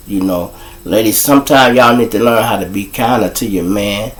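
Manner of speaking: normal talking voice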